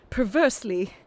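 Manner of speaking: usual speaking voice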